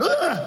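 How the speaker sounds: high, sudden startling grunt; mock scare noise. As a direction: make a noise to spook a rat. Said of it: "I'm gonna scare it away".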